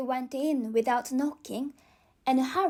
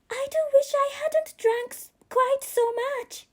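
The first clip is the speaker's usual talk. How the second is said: put-on girl's voice rising higher and louder in alarm; alarmed Alice; 9 semitones higher, 4 dB louder than usual. Alice frets as she keeps growing, rising alarm. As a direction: let the panic climb as she grows.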